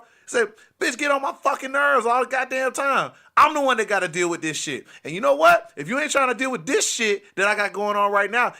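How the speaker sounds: loud, high, furious-sounding tirade; jealous boyfriend rant. Retold or imitated. Boyfriend ordering two men out of the room, loud and angry. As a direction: shout them out with put-on bravado. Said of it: "while I'm talking like this".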